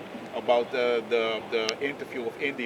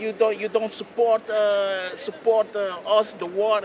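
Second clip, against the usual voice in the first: raised, louder, slowed accusation; accusing journalist; 8 semitones higher, 5 dB louder, slower than usual. Retold or imitated. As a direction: press him hard, like a TV host cornering a guest. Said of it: "and it was like".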